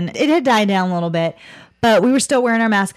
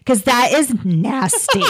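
slow, drawn-out Southern NASTY, higher pitch; Southern accent; 4 semitones higher, slower than usual. Retold or imitated. Flight attendant scolding passengers about tissues, slow Southern accent. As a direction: imitate a funny Southern flight attendant, stretching it out. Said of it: "more of a Southern accent".

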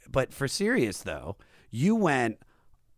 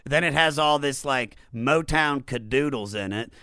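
usual speaking voice

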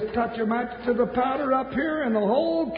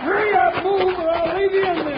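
urgent shout; 6 semitones higher, 3 dB louder than usual. Acted. Escaping the exploding powder keg.

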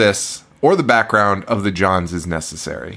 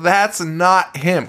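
mild mock yell, pitched up; mock scream; 6 semitones higher, much slower than usual. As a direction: mimic an exasperated plea, stretching it. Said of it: "screaming at people".

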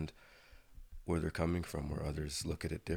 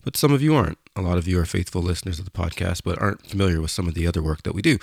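upbeat radio ad voice; ad read; 14 dB louder than usual. Acted. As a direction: sell it like a radio spot. Recorded ad for the band.

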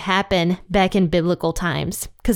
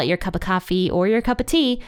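usual speaking voice

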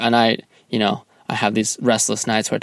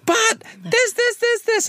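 squeaky high mock whine, much higher; high-pitched teasing imitation of the partner pleading; slower than usual. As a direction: parrot her insistence in a tiny high voice. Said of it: "And you're like".

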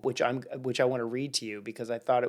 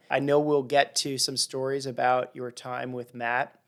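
ordinary talk, nothing marked

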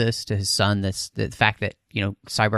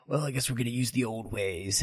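muttered resigned quip, much softer; quiet aside; 10 dB quieter than usual. Retold or imitated. Resigned quip about the sequel.

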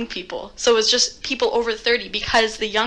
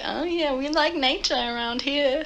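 slow, lightly Canadian; a hint of a Canadian accent; 4 semitones higher, 4 dB quieter, slower than usual. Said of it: "was that your Canadian accent".

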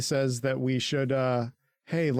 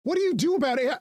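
high, whiny mock voice for Armin; a high, self-pitying put-on voice, laughing through it; 10 semitones higher than usual. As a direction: play him as a spoiled brat, pitched way up. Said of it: "And Armin is like".

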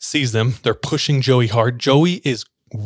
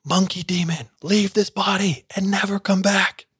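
much higher, building mock scream; rising mock scream; 8 semitones higher than usual. Pastors banishing the monkey demon, higher and building. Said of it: "They scream louder and louder".

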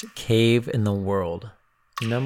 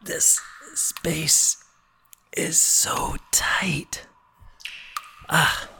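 crawling in crawlspace; 7 semitones higher, slower than usual. Real, not acted. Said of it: "Whew, let me take a break".

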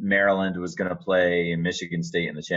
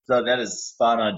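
ordinary talk, nothing marked

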